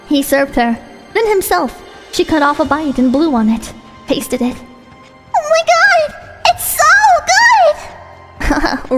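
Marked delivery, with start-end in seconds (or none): 5.4-8.9 put-on squealing high girlish delight; high cute anime girl. Character thrilled by food.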